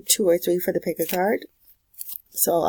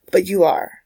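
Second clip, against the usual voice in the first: ordinary delivery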